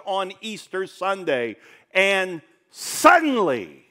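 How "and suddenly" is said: suddenly stretched slow, loud and high, falling away; drawn-out suddenly. Real, not acted. Preacher building suspense for the church, slow and loud. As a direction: hang on and suddenly, letting it fall. Dramatic pause in sermon.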